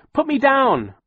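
high, loud desperate yell. Acted. Violet yelling at the creature carrying her. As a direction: yell at the creature to drop you. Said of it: "Violet yelled".

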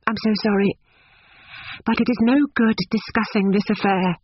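put-on high-pitched, polite young lady; uneasy young woman. A narrator's reading. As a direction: close the subject with strained courtesy. Lucy cuts off the subject.

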